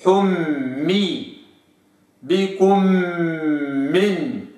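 حمي very slow, m sustained; held m. Shown as a demonstration. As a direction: linger on the m. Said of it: "هكذا".

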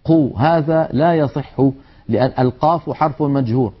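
normal talking voice

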